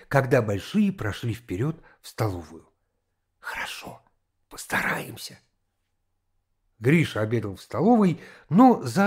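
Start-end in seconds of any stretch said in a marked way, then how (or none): 3.4-5.4 hushed whispered reply. A narrator's reading. Boy secretly promising the girl to try, whispering.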